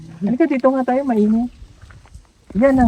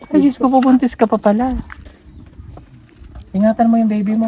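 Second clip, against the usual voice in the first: ordinary talk, nothing marked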